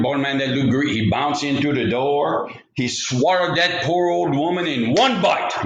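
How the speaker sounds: ordinary delivery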